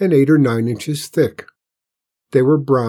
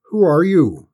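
demanding; 6 semitones higher, 6 dB louder than usual. A narrator's reading. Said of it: "Mister Pinkerton demanded".

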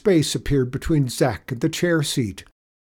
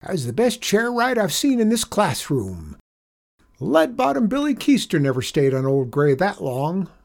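high, delighted cowboy praise; excited cry; 8 semitones higher than usual. A narrator's reading. Janitor praising the boy who tamed the chair, excited. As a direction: crow over the rider's victory. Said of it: "he cried".

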